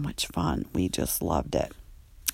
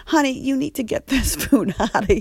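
louder, sassy order through a laugh; Andre's voice; 5 semitones higher, 7 dB louder than usual. Retold or imitated. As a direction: staff member ordering the food out. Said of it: "Andre is like".

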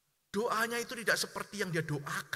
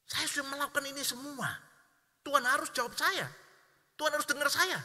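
higher, indignant complaint; resentful believer; 7 semitones higher, 4 dB louder than usual. Retold or imitated. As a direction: insist God owes you an answer. Angry believer voiced. (keluhan marah, suara lebih tinggi)

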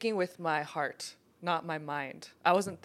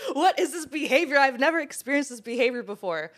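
higher-pitched, giggling through the words; laughing disbelief; 5 semitones higher, 3 dB louder than usual. Retold or imitated. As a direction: laugh while asking in amused disbelief. Retold reaction, laughing throughout.

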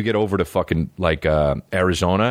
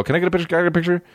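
high, eager fan voice; 10 semitones higher, much faster than usual. Retold or imitated. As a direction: mimic a fan begging for a photo. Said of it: "Everywhere else I go".

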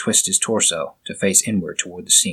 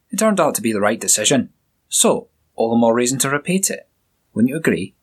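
lightly voiced Scottish sergeant, a bit higher; a hint of a jovial Scottish burr; Scottish accent; 3 semitones higher than usual. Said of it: "with a strong Scottish accent".

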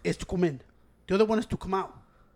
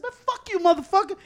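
high-pitched retold cursing, louder at the end; heated; 11 semitones higher, 5 dB louder than usual. Driver so heated he nearly steps out, heated cursing. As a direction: curse him out in rising heat. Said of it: "I got so heated".

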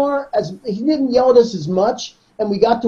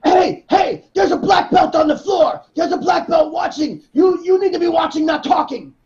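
Eduardo yelling; 7 semitones higher than usual. Retold or imitated. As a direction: bark at chattering students. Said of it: "Eduardo would be like".

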